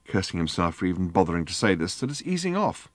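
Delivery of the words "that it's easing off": higher, noncommittal remark; vague. A narrator's reading. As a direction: float it out half-heartedly, pitch lifting. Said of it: "said Arthur vaguely".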